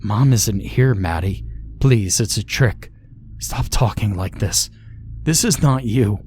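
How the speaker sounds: ordinary delivery, nothing marked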